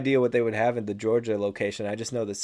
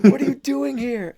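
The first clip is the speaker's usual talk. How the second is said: very high, louder put-on squeak; mock film buff; 13 semitones higher, 8 dB louder than usual. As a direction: challenge them in a silly high voice.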